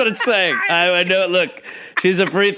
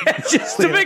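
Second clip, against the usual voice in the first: laughing; 12 semitones higher, faster than usual. Real, not acted. Laughing lead-in.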